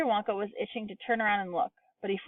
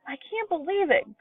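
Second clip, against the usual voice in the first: put-on much higher, thrilled old man; amazed yell; 10 semitones higher than usual. A narrator's reading. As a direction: yell in disbelief. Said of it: "Grandpa Joe was yelling".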